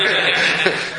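a brief amused chuckle; short laugh. Real, not acted. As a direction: laugh at your own joke. Laughs at own text joke.

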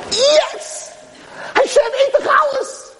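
very high, jubilant exclamation; overjoyed cry. Acted. Peasant finds the bread gone from the ark, overjoyed cry. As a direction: exclaim it in triumph and amazement.

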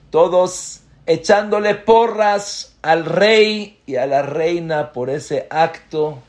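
loud, high, fired-up preaching; preaching at full force. Acted. Rabbi tells his congregation of the cheering crowd, fervent preaching. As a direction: lift the room with the story.

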